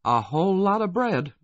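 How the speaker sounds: high, loud woman's voice; Kathy, impressed. A narrator's reading. Kathy remarking on the long grain train, high and loud.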